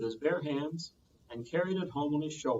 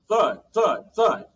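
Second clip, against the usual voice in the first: loud thuds; 6 semitones higher, 11 dB louder, much slower than usual. A narrator's reading. Fight sound effect.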